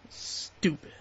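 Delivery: voiceless mutter; muttered verdict. Real, not acted. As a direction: breathe it out with disdain.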